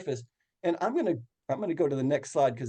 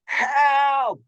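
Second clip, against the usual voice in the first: full-voiced desperate yell; loud cry for help; 9 semitones higher, 6 dB louder than usual. Survivor yelling from the water for rescue, desperate shout. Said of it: "just as loud as I could".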